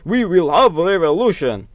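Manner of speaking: rally the comrades in a hammed-up Russian accent; over-the-top Russian accent of a fiery communist. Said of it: "I use the Russian accent".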